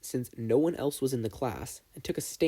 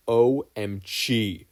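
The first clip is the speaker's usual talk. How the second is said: drawn-out O M G; 6 dB louder, much slower than usual. Acted. Midoriya spells out his exasperation.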